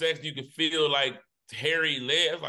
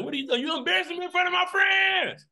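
high, shrieked mock girlfriend; high mock girlfriend yelling at him; embarrassed girlfriend. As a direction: scold him in front of friends. Girlfriend voiced in story.

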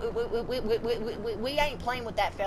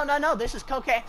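ordinary talk

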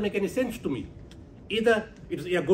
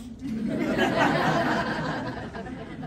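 laugh; 11 semitones higher than usual. Real, not acted. Laughs at own remark.

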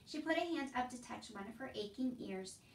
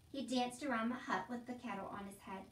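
plain reading voice

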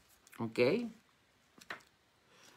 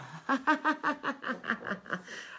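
a burst of high-pitched laughter; bright giggle at the question; 14 semitones higher than usual. Real, not acted. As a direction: laugh it off, amused. Laughing at a comment.

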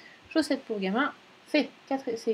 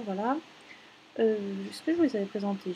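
normal talking voice

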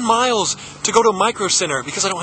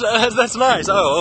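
ordinary talk, nothing marked

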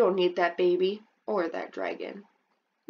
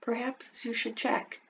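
ordinary delivery, nothing marked

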